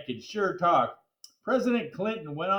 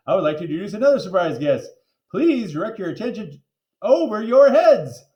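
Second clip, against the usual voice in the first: louder, fast showy announcer; grand announcement; 8 dB louder than usual. Acted. Mrs Dole announces a surprise guest to the crowd, booming. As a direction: introduce the guest like a ringmaster.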